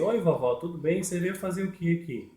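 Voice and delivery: lightly acted question to granny; presenter greeting puppet granny. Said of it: "Eu vou estar falando assim".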